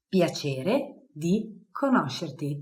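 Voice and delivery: slow, clear Piacere di conoscerti; slow model phrase. Shown as a demonstration. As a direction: say it slowly for learners.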